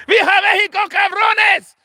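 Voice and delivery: high mock shout. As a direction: yell it like a rally cry. Mock Canelo rally cry.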